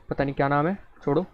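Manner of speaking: ordinary talk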